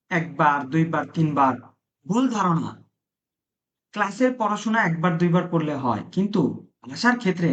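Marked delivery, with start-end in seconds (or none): none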